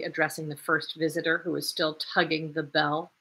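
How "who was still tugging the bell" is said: ordinary delivery, nothing marked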